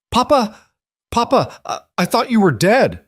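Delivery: put-on high, loud child's cry of relief; a small boy flinging himself at his father; boy's desperate cry. A narrator's reading. Boy runs crying to father.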